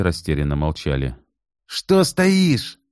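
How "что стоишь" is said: put-on sharp high angry bark at the girl; barked order. A narrator's reading. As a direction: snap at her furiously. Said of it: "рявкнул Кит на девушку". (рявкнул зло)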